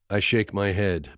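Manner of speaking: ordinary delivery, nothing marked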